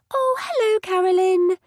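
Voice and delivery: high, bright gushing greeting; excited mum voice. A narrator's reading. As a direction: squeal it like an overjoyed mum.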